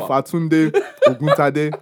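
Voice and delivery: Ifatunde Oguntade with full Yoruba vowels, each syllable clear; proper Yoruba pronunciation. Shown as a demonstration. Podcaster shows friend how to say the doctor's name, proper Yoruba pronunciation. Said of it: "the proper accent".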